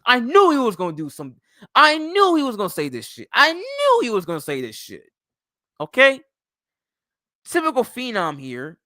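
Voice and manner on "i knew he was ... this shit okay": high, loud exasperated rant; worked-up I-told-you-so outburst. Real, not acted. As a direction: repeat it louder and higher, fed up. Exasperated rant about Phenom's predictable take.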